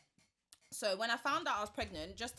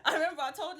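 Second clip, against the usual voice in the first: laughing; 6 semitones higher, 14 dB louder than usual. Real, not acted. Laughing while retelling.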